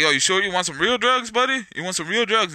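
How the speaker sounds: imitated drug dealer. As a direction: mimic a dealer's offer, pitch lifted. Imitates dealers offering harder drugs.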